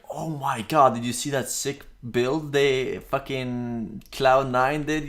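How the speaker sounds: imagined player raving about a build; mock hype. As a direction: gush over a teammate's sick play. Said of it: "that makes it more like".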